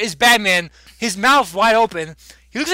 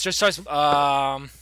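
ordinary delivery

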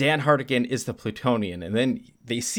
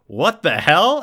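mock shocked outburst, raised and louder; 6 semitones higher, 8 dB louder, slower than usual. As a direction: play her stunned disbelief. Girlfriend shocked by reveal.

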